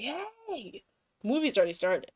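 high mock yell of a man entering. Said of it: "he yells when he comes in".

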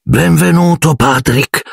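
high, formal greeting; strained politeness; stiff welcome. A narrator's reading. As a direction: welcome him rigidly, without ease. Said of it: "disse Nick tutto rigido".

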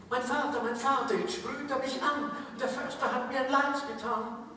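put-on high, pleading child-like tree voice; mock-dramatic Erlking child; frightened fir tree.

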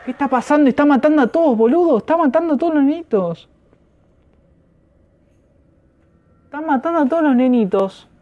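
high, agitated disbelief; high-pitched shocked panic; alarmed. Real, not acted. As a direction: blurt it out in rising alarm. Monster killing the children in game.

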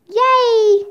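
high, bright little cheer. Acted. Annie cheers the freed bird, happy high cheer. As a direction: cheer it out in delight.

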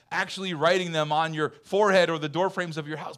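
ordinary talk, nothing marked

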